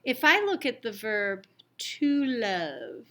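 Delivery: ordinary delivery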